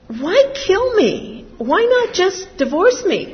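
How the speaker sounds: retold question, high and loud, slow on the first words; mock incredulous. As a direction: throw his question back in disbelief, loudly. Retells husband's question, loud.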